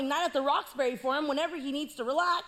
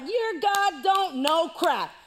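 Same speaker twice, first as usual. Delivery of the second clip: loud, slow mocking taunt; hammed-up giant taunt; 6 semitones higher, 5 dB louder, slower than usual. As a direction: sneer at them like a bully giant. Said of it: "talking all this mess".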